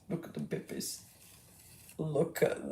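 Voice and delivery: breathless, voiceless whisper of delight. Acted. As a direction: hush it like you might wake them. Cooing over baby foxes twice.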